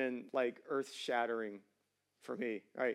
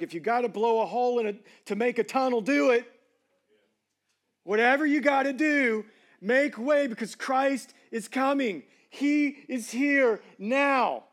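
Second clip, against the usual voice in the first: louder, higher, fervent preacher; full-force preaching; 10 semitones higher, 10 dB louder than usual. Acted. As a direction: proclaim it to the congregation with fire.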